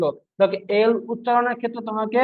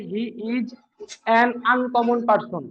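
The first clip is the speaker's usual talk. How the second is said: slow example sentence; much slower than usual. Shown as a demonstration. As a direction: spell out an uncommon person very slowly. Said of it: "কিন্তু যখন বলা হবে". (খুব ধীরে উদাহরণ বাক্য)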